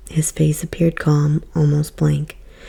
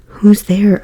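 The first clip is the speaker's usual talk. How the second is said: louder; 4 semitones higher, 6 dB louder than usual. A narrator's reading. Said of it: "I repeated".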